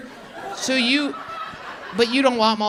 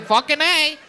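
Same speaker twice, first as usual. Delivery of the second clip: higher, loud hammed-up tailgate yell; 9 semitones higher, 3 dB louder, slower than usual. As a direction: ham it up like a drunk Bills fan. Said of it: "Is that what they say?".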